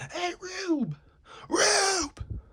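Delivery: high, far-carrying yell for help; calling out. Acted. Slim hollering across the lot for the gang. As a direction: shout across the lot for backup.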